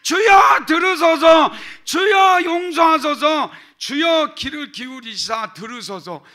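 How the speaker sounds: each Lord shouted high and loud; triple cry of Lord. Shown as a demonstration. Pastor demonstrates the threefold cry of Lord, shouting. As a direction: cry out three times to God. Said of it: "제가 할게요".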